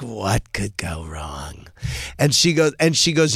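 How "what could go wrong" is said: whispered, slow and ominous; mock whisper. Kim D answering the host's joke, slow whisper. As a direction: hush it like a bad omen. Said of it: "She's like".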